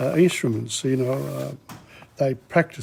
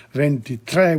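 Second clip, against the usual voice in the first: "ventitré" with the final é stressed; accent on the E. Shown as a demonstration. Teacher showing the stressed final E of "ventitré", careful demo. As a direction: lean on the last E of "ventitré". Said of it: "with the accent on the E".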